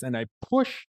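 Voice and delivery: plain reading voice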